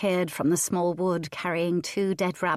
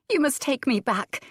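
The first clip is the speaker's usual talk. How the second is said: cried out; 7 semitones higher than usual. A narrator's reading. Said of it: "she cried".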